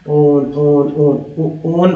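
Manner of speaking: ओन repeated again and again; drilling the sound. Shown as a demonstration. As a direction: drill ओन over and over for students. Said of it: "O N कौन बोलते हैं". (ओन को बार-बार दोहराकर सिखाना)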